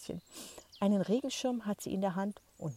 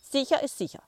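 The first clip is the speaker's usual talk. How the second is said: grandma voice, high and loud; 8 semitones higher, 8 dB louder than usual. Acted. Grandma's wry remark.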